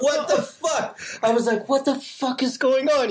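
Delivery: high-pitched mock disbelief; retold incredulous outburst; bewildered. Bewildered after being screamed at.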